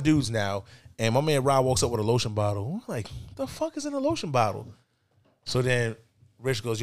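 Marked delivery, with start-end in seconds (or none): 3.1-4.7 very high, puzzled question; baffled disbelief. Retold or imitated. Friends see a lotion bottle brought over, incredulous.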